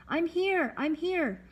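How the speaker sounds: high-pitched yell for attention. A narrator's reading. Stranded boy to the approaching boat, yelling. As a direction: call out high, as if across water. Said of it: "I yelled".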